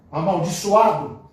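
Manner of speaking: slow menacing curse; cursing tone. Shown as a demonstration. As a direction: spit it out as a curse. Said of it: "a diferença até da, da entonação da voz da gente".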